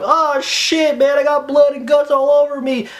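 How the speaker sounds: high, loud freaking out; hammed-up horrified shout; mock panicked outcry. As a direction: yell it like a grossed-out moviegoer. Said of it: "and everyone's going".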